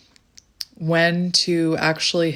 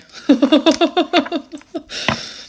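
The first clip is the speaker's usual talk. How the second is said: shocked high laugh; 9 semitones higher, 6 dB louder than usual. Real, not acted. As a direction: burst of high-pitched laughter. Reacts to card.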